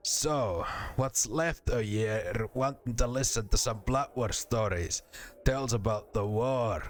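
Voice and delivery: storytelling in a put-on Scottish accent. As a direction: tell war tales like a Scot. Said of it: "Scottish accents are now my number one enemy".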